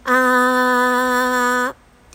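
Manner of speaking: loud sustained ah at speaking pitch; chest voice ah. Shown as a demonstration. Coach demonstrates a chest-voice ah to start the exercise. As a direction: project a steady ah a metre ahead. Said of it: "地声を出して、まず出しますね".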